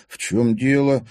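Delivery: frightened. A narrator's reading. Timofey facing sudden accusations, frightened. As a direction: ask it in alarm. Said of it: "испуганно спросил Тимофей".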